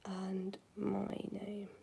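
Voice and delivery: unvoiced whisper, slow. Real, not acted. To her chat, repeating in a whisper. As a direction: breathe the words out.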